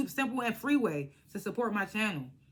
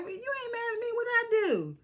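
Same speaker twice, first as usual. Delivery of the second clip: very high imitated pleading; mock innocent protest; 13 semitones higher than usual. [name] answering an annoyed Sweet Tea, high mock-innocent protest.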